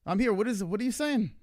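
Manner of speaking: mock calling out. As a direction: wave for attention at the table. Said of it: "I was like".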